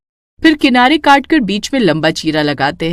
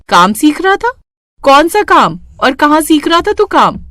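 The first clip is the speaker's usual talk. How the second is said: higher, surprised questioning of her son; 7 semitones higher, 4 dB louder than usual. A narrator's reading. Mother surprised by her son's news, questioning him. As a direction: ask it in disbelief.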